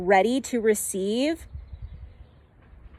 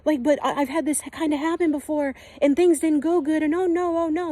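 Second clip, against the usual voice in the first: much higher, fretful "oh no" whine; panicky ego self; 6 semitones higher than usual. Pretend. Guide acts out the ego self panicking, high and fretful. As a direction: play the scared ego fretting over past hurts. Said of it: "your ego self".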